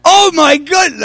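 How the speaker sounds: astonished. Retold or imitated. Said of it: "I don't say this out loud".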